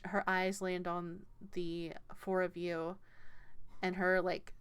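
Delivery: ordinary talk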